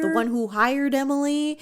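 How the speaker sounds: ordinary talk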